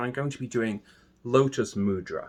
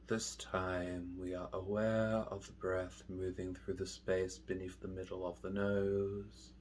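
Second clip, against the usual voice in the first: slow, soft, lower meditation voice with long pauses; hushed, unhurried guidance, letting each phrase settle; guided meditation; 4 semitones lower, 13 dB quieter, slower than usual. Real, not acted. Meditation teacher guiding listeners through mindful breathing, slow and soft.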